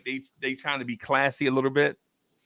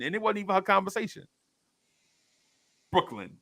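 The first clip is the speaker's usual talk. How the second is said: ordinary delivery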